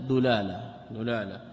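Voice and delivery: دلالة with a u after the dal, "dulala"; damma on the dal. Shown as a demonstration. Teacher showing students the irregular form, دلالة with damma, "dulala". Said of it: "وشذ".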